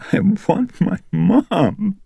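put-on very high, slow, whimpering little girl; a crying child begging for her mother, drawn out; tearful child. A narrator's reading. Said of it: "As the tears streamed down her face".

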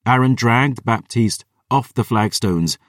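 plain reading voice

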